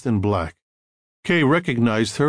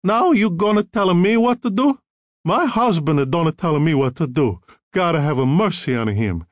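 withering contempt; 6 semitones higher than usual. A narrator's reading. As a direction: sneer at him in an Italian accent. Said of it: "a look of withering contempt".

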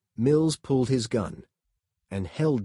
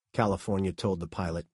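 ordinary delivery

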